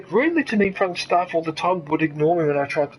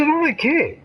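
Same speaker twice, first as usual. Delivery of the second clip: defiant retort; 8 semitones higher, slower than usual. Retold or imitated. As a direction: throw back a flat refusal, raised. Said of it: "I said".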